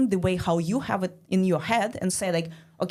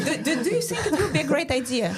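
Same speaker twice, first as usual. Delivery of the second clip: much higher, very fast, laughing; stammering mock pitch; 7 semitones higher, much faster than usual. Asking Shani about the idea, a high, rushed, laughing stammer. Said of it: "But, like".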